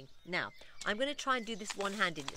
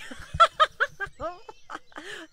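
bright laugh; 12 semitones higher, 12 dB louder than usual. Real, not acted. Laughing at flat batteries joke.

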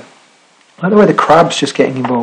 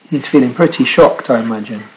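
plain reading voice

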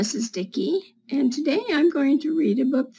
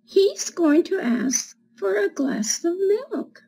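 put-on reading to children; 3 semitones higher than usual. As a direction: read it like storytime for little kids. Reads picture book to children.

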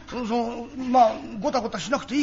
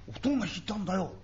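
ordinary delivery

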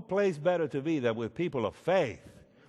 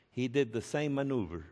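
normal talking voice

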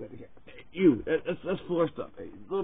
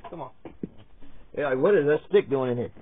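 usual speaking voice

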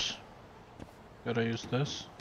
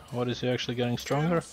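ordinary delivery

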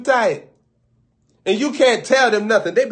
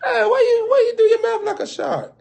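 very high squealed question; high-pitched teasing question. Acted. Someone asking about a tightly braided customer's mouth, high and squealing. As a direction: ask it in a high, incredulous squeal.

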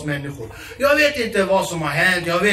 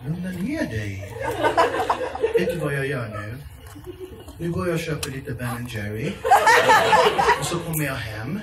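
put-on soothing boyfriend; 5 semitones lower than usual. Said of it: "boyfriend's soothing reply".

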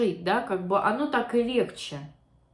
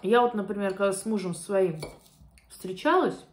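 chewing while talking; speaking around food; mouth full. Real, not acted. Seen 0: Bite taken at -4; small, full-looking mouth while talking to about 4.5.

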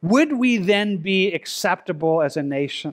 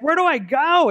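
desperate plea; 10 semitones higher, 4 dB louder than usual. Retold or imitated. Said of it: "You would say with the Apostle Paul".

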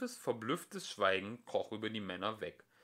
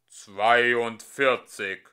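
slow, grand and louder pronouncement; solemn majesty; 13 dB louder, much slower than usual. Acted. The computer reveals the answer with lofty calm. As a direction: unveil it like a sacred truth. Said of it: "mit unsagbarer Erhabenheit und Ruhe".

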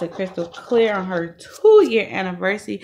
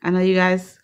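usual speaking voice

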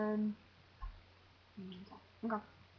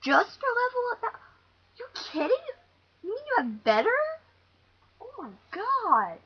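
high, loud disbelief; incredulous outburst; 11 semitones higher, 7 dB louder than usual. Real, not acted. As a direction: exclaim it in outraged amazement. Disbelief at what happens in game.

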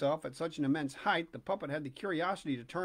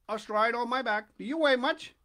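calling pigeon; 5 semitones higher, 6 dB louder than usual. Acted. Pigeon voiced.

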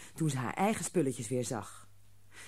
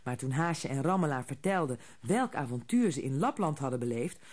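plain reading voice

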